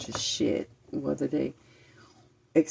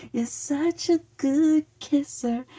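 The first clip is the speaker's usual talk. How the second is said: high, slow and dreamy; flirty imagined voice; 9 semitones higher, slower than usual. Retold or imitated. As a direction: coo it like a sweet-talking lover. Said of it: "I could hear".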